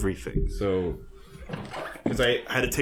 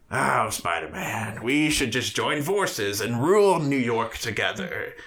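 slow, drawn-out villain pitch; Green Goblin; slower than usual. Retold or imitated. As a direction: coax grandly as a hammed-up villain. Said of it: "and he's like".

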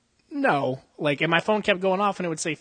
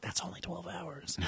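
laughing; 3 semitones higher, 5 dB louder than usual. Real, not acted. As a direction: laugh it out under your breath. Laughing through retold retort.

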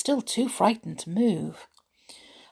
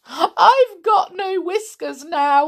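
wept; 8 semitones higher, 5 dB louder, slower than usual. Acted. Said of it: "wept the poor little clockwork mouse".

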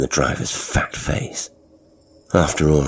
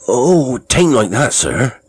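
higher and louder, a nervous rush; blurted; 8 semitones higher, 6 dB louder, slower than usual. A narrator's reading. Lorry driver flustered, blurting out to his passenger, higher and louder. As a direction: blurt it out, flustered, to calm him. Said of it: "he blurted".

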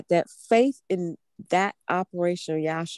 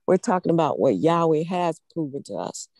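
ordinary talk

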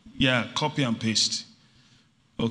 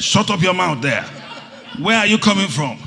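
raised, mock-angry telling-off; loud, higher retold scolding; 6 semitones higher, 7 dB louder than usual. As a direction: cut the excuses off loudly.

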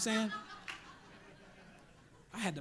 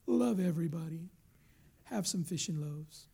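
mock-nice; 3 semitones lower than usual. Preacher mocking a tame idea of Jesus, mock-nice. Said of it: "Jesus just taught nice little things".

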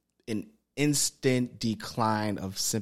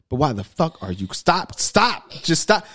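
acted exasperation, rising higher and louder; exasperated; 6 semitones higher, 4 dB louder than usual. Man telling someone crying to stop, exasperated. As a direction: cut them off, voice climbing. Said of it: "I can understand why you're crying".

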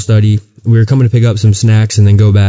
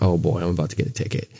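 much softer, quick muttered thought; worried aside to himself; 6 semitones lower, 13 dB quieter, faster than usual. Retold or imitated. Telling friends about spotting the cop, a hushed quick aside. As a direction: mutter it under your breath, quick and worried. Spots police car while praying.

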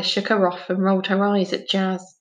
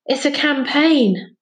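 higher, slow out-of-breath line; panting; 6 semitones higher, 4 dB louder, slower than usual. A narrator's reading. Finn arriving after sprinting from the cottage, panting. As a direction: push it out as if winded. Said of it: "panted a voice behind them".

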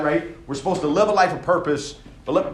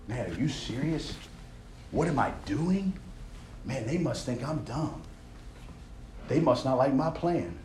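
soft, deflated self-doubt; discouraged mutter; 3 semitones lower, 8 dB quieter than usual. Acted. Builder doubting himself after mockery, soft and deflated. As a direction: talk yourself out of it quietly.

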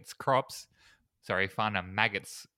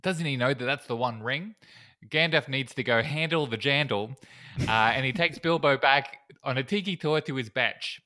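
hammed-up Kiwi vowels; bad New Zealand accent; 3 semitones higher, 5 dB louder than usual. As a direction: badly imitate a New Zealander. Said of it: "the terrible New Zealand thing".

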